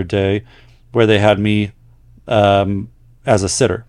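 letter-reading voice; reading a letter aloud. Acted. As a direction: read the listener's story out to the audience. Reads a listener's letter aloud.

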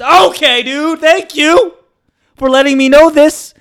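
very high, loud mock thanks; acted-out freaked-out politeness; awkward alarm. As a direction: cut him off in a high, strained pitch. Awkward reaction to oversharing.

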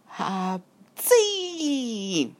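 high drawn-out acted sneeze; hammed-up sneeze on a high note; huge sneeze. A narrator's reading. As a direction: build up, then burst into a long squealed sneeze. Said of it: "Akkorát tüsszentett".

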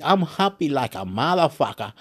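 ordinary talk, nothing marked

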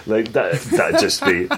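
usual speaking voice

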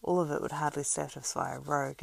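plain reading voice

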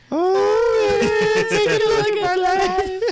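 drawn-out, very high singer impression. Friend teasing [name] next time, high slow wailing impression. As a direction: stretch it into a high, mournful wail. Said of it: "bust out into that and be like".

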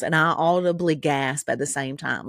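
ordinary talk

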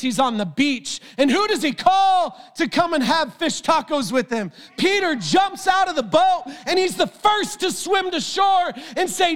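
high, impassioned preacher voice, often shouting; full-force preaching. Acted. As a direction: preach it with fire to the crowd. Preaching to congregation, then podcast outro.

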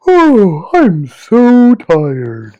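high, loud, drawn-out toy voice; exaggerated sleepy yawn of a talking toy; Sleepy Time Ernie. Storyteller imitating a sleepy toy going off, loud slow yawning voice. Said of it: "Sleepy Time Ernie. He was like".

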